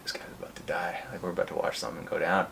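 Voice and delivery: quick excited whisper; hushed whisper. Retold or imitated. Friends whisper as a fight seems about to start, hushed.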